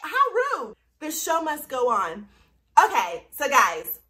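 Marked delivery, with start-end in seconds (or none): 0.0-0.8 mock offended.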